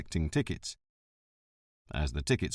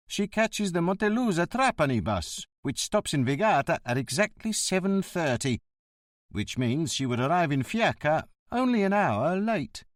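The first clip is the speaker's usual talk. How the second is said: a lightly voiced surly driver, a touch higher; surly bus driver; 7 semitones higher than usual. Driver answering the inspector's questions, lightly gruff and higher. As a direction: play the grumbling ticket man.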